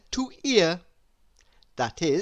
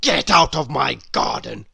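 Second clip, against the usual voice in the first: loud, cross grumble; a bad-tempered old grouch barking back; grumpy Mister Grumpy; 3 semitones lower, 6 dB louder than usual. Grumpy orders Happy away.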